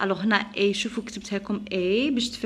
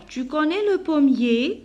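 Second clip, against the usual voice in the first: raised, deliberate reading question; pitched-up teacher's question; question intonation; 5 semitones higher, 3 dB louder than usual. Shown as a demonstration. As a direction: lift "tu connais le pommier" into a question. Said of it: "C'est une question".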